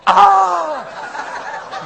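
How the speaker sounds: very high, loud mock scream; junior high girl shriek. Preacher mocks Jonah as hopped-up girl, shrieking. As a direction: shriek like an overemotional teen. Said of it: "like a junior high girl all hopped up on emotion".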